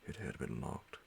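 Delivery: plain reading voice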